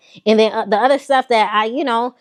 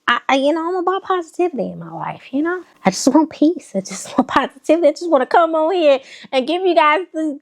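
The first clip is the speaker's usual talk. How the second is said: mock sweet voice; 8 semitones higher than usual. Sugary plea for positivity.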